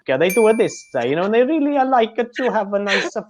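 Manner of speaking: strong Indian accent, high and sing-song; high-pitched Indian English accent demo. Shown as a demonstration. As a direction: exaggerate a lilting Indian accent. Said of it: "very strong Indian accent".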